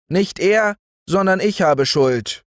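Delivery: put-on high boy's voice, mildly grave; earnest boy. Boy confessing his guilt to the family, mild earnestness. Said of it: "antwortete der Knabe mit tiefem Ernst".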